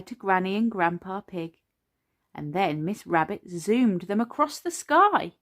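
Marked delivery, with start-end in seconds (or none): none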